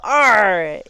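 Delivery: loud arr like a stubbed toe; pained pirate yell. Shown as a demonstration. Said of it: "or you might yell".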